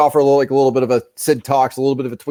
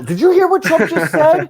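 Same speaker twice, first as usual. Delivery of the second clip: mocking outraged people; 14 semitones higher than usual. Streamer mocking offended viewers, high and laughing. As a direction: ham up the scandalized gossip, much higher. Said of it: "I was just picturing people being like".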